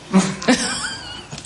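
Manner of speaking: bright burst of real laughter; laughing at her own joke about the coast. Real, not acted. A Venezuelan woman laughs at her own joke about the coast, real laughter.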